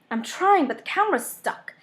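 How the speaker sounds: panicked tug at a stuck strap; strained urgency. Acted. Heroine struggling with stuck strap.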